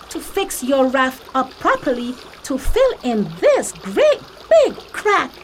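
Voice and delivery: squeaky straw asking for rescue, urgent; Emmy the straw pleads with Kim to save her family; Emmy the straw pleading. Acted. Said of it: "said Emmy".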